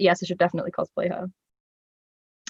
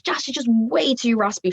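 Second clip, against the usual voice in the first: ordinary delivery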